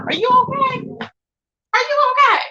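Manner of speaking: high, drawn-out mock concern. Mocking Z X's gums with sarcastic concern. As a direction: ask it as if he's clearly not okay.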